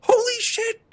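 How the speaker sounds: high, loud mock outcry; retold startled shout. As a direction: act out your own loud shock. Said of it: "And I went".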